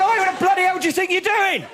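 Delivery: high, loud outraged yell; woken and furious. Retold or imitated. Woken at four by a head-stamp, yelling at the stranger. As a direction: blast him for stamping on your head. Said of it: "I went".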